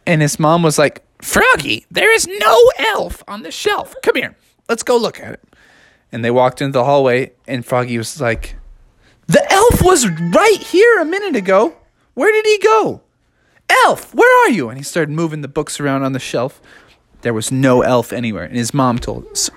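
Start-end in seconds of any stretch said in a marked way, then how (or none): 1.2-5.4 much higher, quick, puzzled mom voice; squeaky disbelieving mother; a high bewildered cartoon mom. 9.3-14.7 high, urgent little frog voice; Froggy calling out for the elf; high anxious cartoon frog; worried Froggy.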